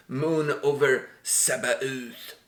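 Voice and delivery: put-on slow Quebec French accent; mock Quebec French accent. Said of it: "this is my Quebec accent".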